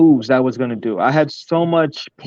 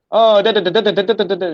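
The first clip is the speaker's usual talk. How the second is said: high, quick nonsense patter; mock auctioneer; 7 semitones higher, 3 dB louder, faster than usual. Shown as a demonstration. Screwing around with people for fun, mock auctioneer patter. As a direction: rattle syllables high, just for fun. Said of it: "just doing it to have fun".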